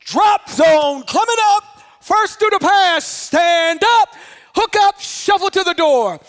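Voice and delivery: high, loud string of jump orders; stretched, hollered jump commands; instructor's shouted commands. Retold or imitated. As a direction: bark out commands over roaring aircraft noise. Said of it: "They come back in".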